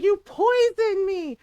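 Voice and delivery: high, loud, drawn-out accusation; Llewellyn screaming. Retold or imitated. Llewellyn accusing Solus of poisoning, loud imitated scream. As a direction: cry it out in mock outrage. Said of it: "it's basically Llewellyn screaming".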